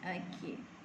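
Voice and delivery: normal talking voice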